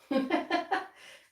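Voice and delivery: bright burst of laughter. Real, not acted. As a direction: laugh out at the idea. Laughing at language confusion.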